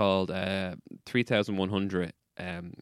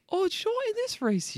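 much higher mock voice of the pudgy Meath runner; imitating the Irish runner. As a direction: ham up the runner's sincere advice. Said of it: "He was like".

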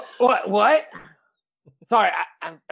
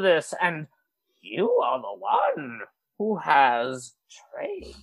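put-on wise old master; 9 semitones higher, 6 dB louder than usual. Master character speaks to hero.

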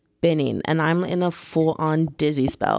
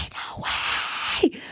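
stunned reader whisper; whispered excitement; 10 semitones higher, 5 dB quieter, much slower than usual. Retold or imitated. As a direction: gasp it out in a waiting room. Reacting to book in waiting room.

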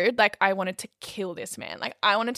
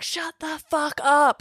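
drawn-out, raised mock yell; imitating screaming neighbour; 7 semitones higher, 3 dB louder, slower than usual. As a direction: mimic an angry neighbour yelling, stretched out. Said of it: "banging and screaming".

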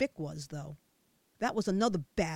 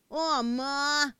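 wailing; 5 semitones higher, 6 dB louder than usual. A narrator's reading. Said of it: "Pasquale wailed".

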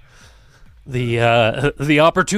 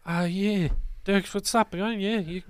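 Bark's voice; 6 semitones higher than usual. Acted. GM voicing Bark greeting the party, high and chummy. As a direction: play the chummy bouncer pitched way up. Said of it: "Bark looks at you and he just goes".

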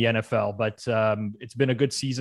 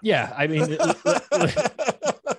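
laughing; 11 semitones higher, much slower than usual. Real, not acted. Laughing mid-sentence, stumbling.

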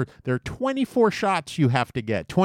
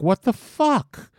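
mock teen outrage; 7 semitones higher than usual. Teens reacting in health class.

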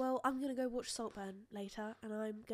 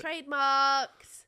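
drawn-out trademarked; 3 semitones higher, 10 dB louder, much slower than usual. Pretend. Girlfriend echoes the joke business idea, drawn out. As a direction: stretch it out like a grand declaration.

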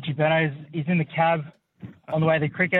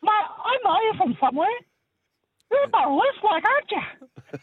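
very high comedy cabbie voice, pitched far above normal; high-pitched taxi driver. Acted. Cab driver thinks he recognises his passenger, very high-pitched voice. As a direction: squeak it out in a high falsetto. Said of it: "he's got the really high-pitched voice".

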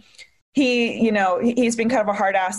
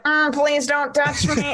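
slow, higher mock-timid plea; awkward pleading; 5 semitones higher, slower than usual. Imitating her younger self turning someone away, awkwardly. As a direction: act out a drawn-out, awkward protest. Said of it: "I was, like, really awkward".

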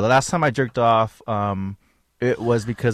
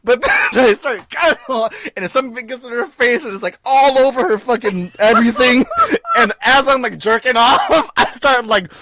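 laughing through it; 10 semitones higher, 4 dB louder than usual. Real, not acted. Laughing while telling the punchline.

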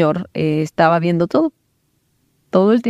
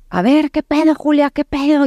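high-pitched, confrontational demand; retold aggressive challenge, pitched well up; super aggressive, not yelling; 10 semitones higher than usual. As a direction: get in her face without shouting. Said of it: "empieza a ser súper agresiva, todavía no gritando".

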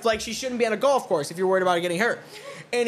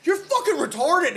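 higher-pitched mock outburst; exasperated insult; 8 semitones higher than usual. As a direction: imitate a baffled man giving up angrily. Baffled golfer's parting insult, acted.

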